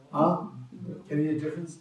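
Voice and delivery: ordinary delivery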